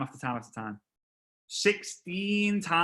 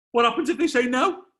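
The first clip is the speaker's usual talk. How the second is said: mock panic; 7 semitones higher, 6 dB louder than usual. Retold inner panic.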